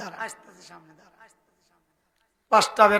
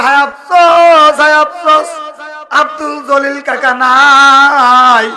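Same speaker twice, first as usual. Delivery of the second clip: loud wailing chant, higher, last word drawn very long; mock lament; 4 semitones higher, 8 dB louder, much slower than usual. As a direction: cry out the death news in a hammed-up wail. Acted public announcement of a death. (সুর করে টেনে শোকের বিলাপের অভিনয়)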